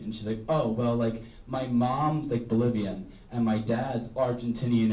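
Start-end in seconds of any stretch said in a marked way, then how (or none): none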